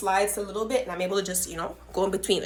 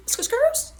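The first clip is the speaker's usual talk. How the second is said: squeaky skirts; 5 dB louder than usual. Retold or imitated. Fleeing, comic exclamation.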